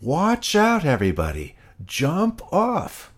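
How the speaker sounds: shouting a warning. A narrator's reading. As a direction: yell to warn everyone. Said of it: "He shouted".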